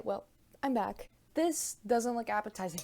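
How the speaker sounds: ordinary talk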